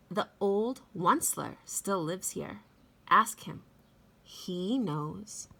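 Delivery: lively read-aloud with big swoops in pitch; storybook reading. A narrator's reading. Teacher reading The Lorax to children, expressive read-aloud voice. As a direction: read it like story time for little kids.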